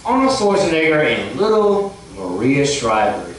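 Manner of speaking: usual speaking voice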